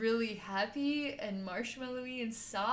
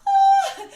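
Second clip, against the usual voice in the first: high loud shout of release; ecstatic release cry; 19 dB louder than usual. Retold or imitated. Energy bursting from the chakra portals, loud high cry. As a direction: let it burst out of you. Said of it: "chakra portals are just like".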